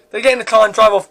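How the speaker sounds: ordinary delivery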